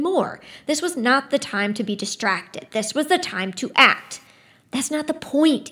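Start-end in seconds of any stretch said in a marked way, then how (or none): 4.7-5.7 sharp, frustrated snarl. Acted. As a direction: snap it at them angrily. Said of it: "he snarled".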